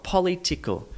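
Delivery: political mispronounced with a full "polly" start; polly-TICK-al; wrong stress. Shown as a demonstration. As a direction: raise political in pitch, said as "polly-tical". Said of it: "not political".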